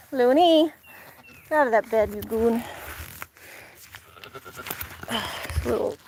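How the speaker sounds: ordinary talk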